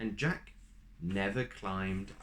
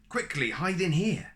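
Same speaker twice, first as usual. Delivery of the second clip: high, louder hurry; urgent; 8 semitones higher, 6 dB louder than usual. Acted. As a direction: hurry him into hiding. Giantess urges Jack hide.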